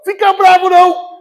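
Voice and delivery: loud, high mock consolation; mock soothing. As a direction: parody useless advice, high and loud. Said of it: "O que que a pessoa fala?". (consolo debochado, alto e agudo)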